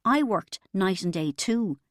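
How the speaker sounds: ordinary delivery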